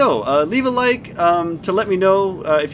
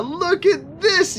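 higher and slow, awed delight; amazed wonder; 9 semitones higher, much slower than usual. Real, not acted. Butterfly finally flies in game.

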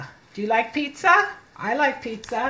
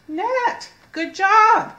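cheerful praise; 10 semitones higher, 6 dB louder, much slower than usual. Real, not acted. Teacher praising child's reading.

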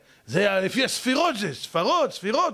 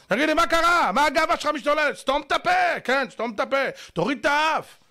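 high, insistent telling-off; indignant rebuke; 7 semitones higher than usual. Acted. Lecturer rebuking a proud husband, indignant scolding. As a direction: take his pride down with sharp high-pitched orders.

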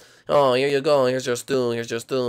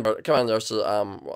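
normal talking voice

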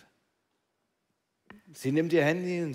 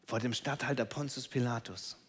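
ordinary talk

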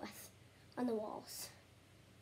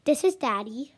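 loud made-up accent; 8 dB louder than usual. Shown as a demonstration. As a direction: belt it in a weird accent. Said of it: "So you wanna say".

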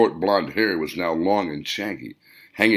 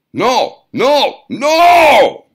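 very high, rising furious yells; building shrill gnome shout; 13 dB louder, much slower than usual. A narrator's reading. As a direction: scream each no louder than the last. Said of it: "shouted the client".

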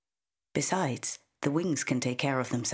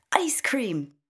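put-on high and louder, a gleeful child's shout; child's excited shout; 8 semitones higher, 3 dB louder than usual. A narrator's reading. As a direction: burst out with the answer like a delighted kid. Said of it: "she shouts".

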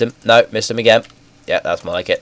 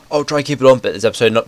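normal talking voice

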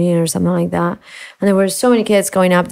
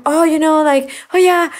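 flustered apology; 6 semitones higher than usual. Retold or imitated. Rambling apology to cashier.

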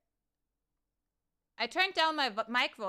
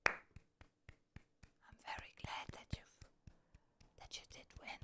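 soft, hushed whisper with pauses; whispered congratulations; 8 dB quieter than usual. Real, not acted. Streamer congratulating the viewer who won, whispering. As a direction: whisper praise to the winner.

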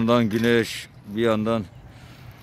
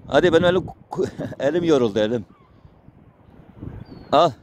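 higher, cooing pet talk; fond pet talk; 5 semitones higher than usual. Real, not acted. Feeding strays, praising and cooing at them, higher fond voice. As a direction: coo at the animals as they eat. Talking fondly to animals while feeding.

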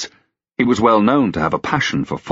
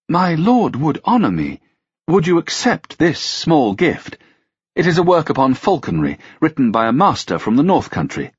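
courtly lady; 8 semitones higher than usual. A narrator's reading. Melanthe offers a gift.

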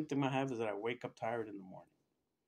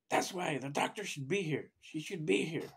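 indignant retold complaint, pitched up; upset nurse; 5 semitones higher, 4 dB louder than usual. Nurse complaining to a colleague about the doctor, upset. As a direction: imitate a nurse insisting, a touch angry. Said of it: "She was upset, visibly upset".

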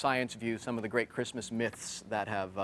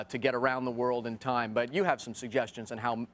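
ordinary talk

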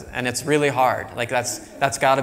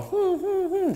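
imitating a dog's much higher coaxing hum; a little high-pitched dog sound to invite you in; dog's high inviting whimper; slower than usual. Lecturer imitating a dog inviting you closer, high coaxing hum. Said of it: "but it'll do a little".

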